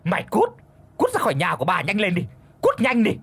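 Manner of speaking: shrill yelled eviction; furious shout. Acted. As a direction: scream her out the door. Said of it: "gầm lên".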